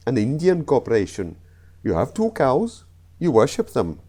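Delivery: comic put-on Indian accent. Comedian telling the Indian corporation joke, Indian accent. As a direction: try a rough Indian accent. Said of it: "I know the accents were awful".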